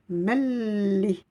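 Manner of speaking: MELLI with a long, held double L; insistent L. Shown as a demonstration. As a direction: slide straight into a stretched L, no n. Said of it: "je vais insister sur la lettre Lem".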